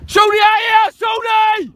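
high, carrying shout; real touchline holler; calling out. Real, not acted. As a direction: call a player from far across the pitch. Coach calling player over.

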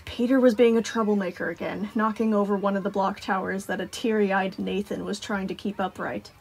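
usual speaking voice

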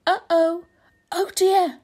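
high, loud worried cry; oh no, it is going to break; alarmed. A narrator's reading. Crowded animals gasp as the house gives way, alarmed.

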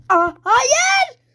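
laughing shout of dismay; surprised shout. Real, not acted. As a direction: yelp "no" with a laugh. Gamer dies suddenly in game.

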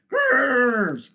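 very loud, high growl; beast growl. A narrator's reading. Beasts reacting to the ape's news, growling loudly. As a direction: roar out a long growl. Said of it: "growled the beasts".

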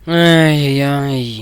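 drawn-out loud protesting call; long loud complaining call. Real, not acted. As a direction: cry out against the move. Protesting an opponent's move.

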